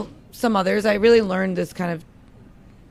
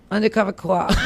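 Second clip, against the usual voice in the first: put-on laughing Boston accent; 4 dB louder than usual. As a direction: drop the r like a Bostonian. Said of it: "You gotta say it in a Boston accent".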